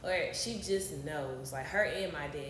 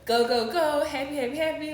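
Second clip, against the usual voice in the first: much louder and higher, bouncing go go go; hyper kid energy; 7 semitones higher, 14 dB louder than usual. Shown as a demonstration. Describing herself to the host, giddy and much louder. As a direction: bubble over like a kid with too much energy. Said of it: "I'm like a little kid".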